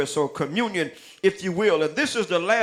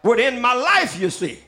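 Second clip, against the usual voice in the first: preaching the lyrics; 4 semitones higher than usual. Acted. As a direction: preach the song lines to the church with mounting fire. Preacher declaims song lyrics, rising.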